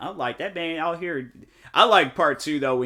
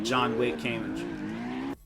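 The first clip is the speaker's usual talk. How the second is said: slow quote; 5 semitones lower, 7 dB quieter, slower than usual. Retold or imitated. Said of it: "And people was like".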